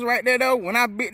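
biting into sausage; talking around food; mouth full. Real, not acted. Seen 0: Sausage held at mouth, then bitten and in mouth 1.1–2.1s.

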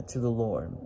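normal talking voice

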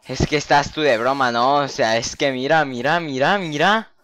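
loud frantic urging. Real, not acted. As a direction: call out in alarm. Frantic at the mob swarm.

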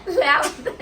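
high, laughing agreement. Real, not acted. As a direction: say it through a burst of laughter. Laughing along with the other speaker. (हसत हसत, उंच आवाजात)

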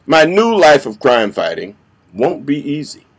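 ordinary talk, nothing marked

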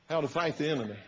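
ordinary delivery

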